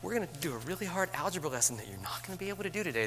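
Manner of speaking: high, syrupy greeting that slows on darlings, then a fast breezy rush; pasting on a cheerful voice while panicking inside; sugary put-on teacher voice hiding panic; fake-sweet teacher. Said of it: "But I'm gonna come back to you and say".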